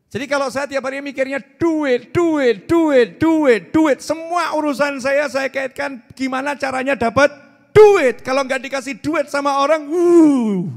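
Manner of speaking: high, loud money-obsessed ranting; mock greedy rant. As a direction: shout duit duit like a greedy man. Preacher ranting about money greed.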